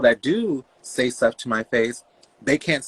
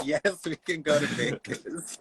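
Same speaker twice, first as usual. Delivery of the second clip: high, slow, through laughter; amused retold yes, laugh in the words; laughing agreement; 4 semitones higher, 5 dB quieter, slower than usual. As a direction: give in while cracking up. Laughing at mom's request.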